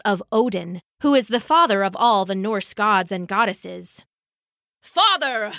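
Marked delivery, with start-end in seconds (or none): 4.8-5.5 higher, urgent call; mock roar. Thor bursting into his father's hall, mild roar. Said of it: "roared Thor".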